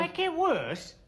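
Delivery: high, incredulous throwback; indignant, pitched right up; outraged echo. Acted. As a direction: fling the words back in outrage. Throws his words back in outrage.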